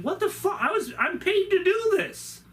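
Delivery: high, outraged complaining; indignant protest. Retold or imitated. Costumed man protesting to Dallas, high indignant. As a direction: defend your job, affronted.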